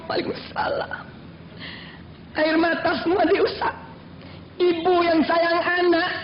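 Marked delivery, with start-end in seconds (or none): 0.1-1.1 softly whispered salutation; hushed response. Acted. Worshipper finishing dawn prayer, whispered. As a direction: let it fade to a whisper.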